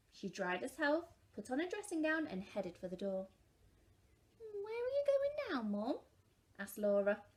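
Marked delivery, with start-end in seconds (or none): 4.4-6.0 put-on very high curious child; child's voice. A narrator's reading. Laura asking Mum where she goes, high child voice. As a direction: call it like a small girl.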